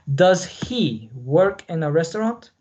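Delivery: slow, careful read for learners; teacher reading a model question slowly; slow model sentence. Shown as a demonstration. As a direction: give each word its own space. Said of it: "Les anoté estos ejemplos".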